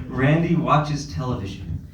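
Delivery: ordinary talk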